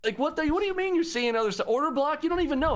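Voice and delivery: incredulous rant. Real, not acted. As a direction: pitch way up in disbelief.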